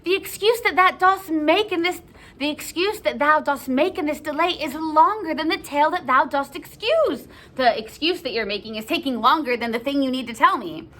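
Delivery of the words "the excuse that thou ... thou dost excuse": raised, insistent theatrical complaint; exasperated scolding. Acted. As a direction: scold the stalling nurse. Juliet scolds the stalling nurse.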